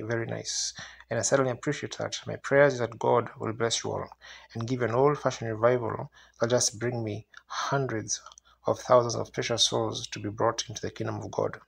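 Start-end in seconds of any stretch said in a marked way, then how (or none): none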